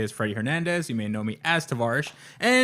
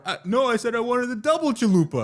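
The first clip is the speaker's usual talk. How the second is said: picky customer; 10 semitones higher, 3 dB louder than usual. Acted. Acting picky Taco Bell customer.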